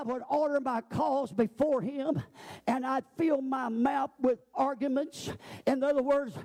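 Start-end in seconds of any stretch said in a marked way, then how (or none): none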